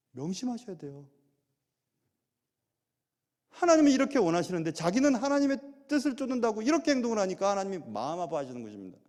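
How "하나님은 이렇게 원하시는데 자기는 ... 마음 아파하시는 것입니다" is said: ordinary delivery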